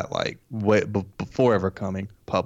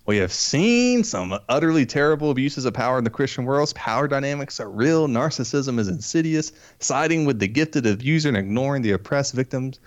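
raised, emphatic reading voice; dramatic reading; 5 semitones higher than usual. Acted. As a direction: read it with mock gravity.

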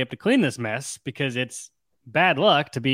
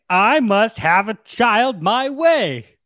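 a touch of a higher, slow snap; snapping; 8 semitones higher, 4 dB louder, much slower than usual. Acted. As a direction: snap it out, drawn out. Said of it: "Irulan snaps at him".

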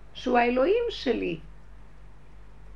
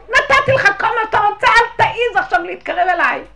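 furious yelling; 12 semitones higher, 15 dB louder, slower than usual. Retold or imitated. Furious outburst at her tormentor.

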